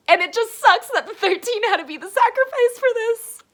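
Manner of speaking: high, loud tearful wail of a sentence; crying. Real, not acted. Reader upset that the thirteen were sacrificed, crying. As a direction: protest the loss through real tears. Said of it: "I'm starting off crying".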